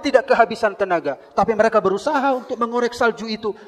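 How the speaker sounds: loud fervent sermon voice; high, loud pulpit delivery; preaching at full force. Acted. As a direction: preach the story to the back row.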